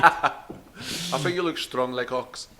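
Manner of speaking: slow, laughing Russian strongman voice; Russian accent. Pretend. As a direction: lay on a thick Russian accent, slowly. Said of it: "That was my Welsh accent".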